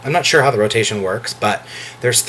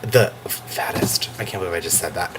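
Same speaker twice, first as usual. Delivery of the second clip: guilty whisper; 8 semitones lower, 8 dB quieter than usual. Real, not acted. Vlogger catching himself mid-insult, guilty whisper. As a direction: drop to a sheepish whisper. Embarrassed by his own remark.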